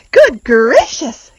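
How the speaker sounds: loud, slow startled gasp. Acted. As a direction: gasp it as if caught off guard. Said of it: "gasped Maisie".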